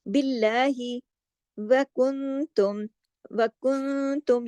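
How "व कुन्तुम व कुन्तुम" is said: व कुन्तुम with a nasal ghunna on the noon; ikhfa nasal. Shown as a demonstration. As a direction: hide the noon of कुन्तुम in the nose. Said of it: "गुन्ना भी हमने".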